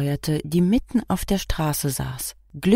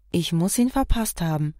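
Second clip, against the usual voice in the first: plain reading voice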